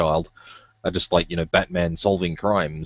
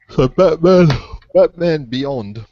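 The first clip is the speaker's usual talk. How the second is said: "So Batman" said much louder and higher, pushing "Batman"; emphatic restart; 9 semitones higher, 8 dB louder, slower than usual. Real, not acted. Getting the chat back on track after a yawn, a loud "So Batman". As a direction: boom out "So Batman" to grab the thread again. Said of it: "clears throat".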